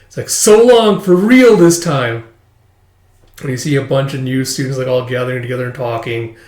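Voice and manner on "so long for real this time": dramatic farewell. Acted. Acted farewell after class placement.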